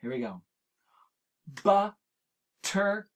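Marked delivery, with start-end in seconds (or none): 1.6-3.1 butterfly stretched slowly into three beats, but-ter-fly; chunked into syllables. Shown as a demonstration. Teacher chunking butterfly for the children, very slow. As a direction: drag butterfly out beat by beat. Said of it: "So let's do butterfly".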